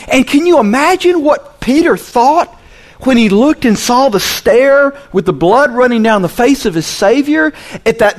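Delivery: high-pitched, forceful sermon delivery; impassioned preaching. Real, not acted. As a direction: drive it home to the congregation.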